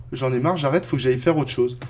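ordinary talk, nothing marked

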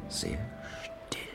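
very soft, trailing to a whisper; hushed. A narrator's reading. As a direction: let it sink away into a whisper. Narrator hushes as all falls still.

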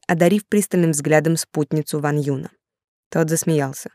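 ordinary delivery

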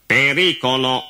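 high, monotone robot voice; computer voice. Acted. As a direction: warn like a toneless computer. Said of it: "con la sua voce piatta da computer". (voce piatta da computer)

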